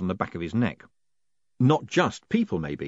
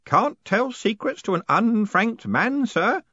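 put-on high, slow, pious old postman; sanctimonious underling in a high voice; 12 semitones higher, 4 dB louder, slower than usual. A narrator's reading. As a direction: say it piously, pitched high and drawn out. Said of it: "said Groat piously".

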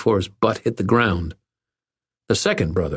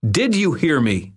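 demanding; 9 semitones higher than usual. A narrator's reading. Irwin confronting the bully he holds.

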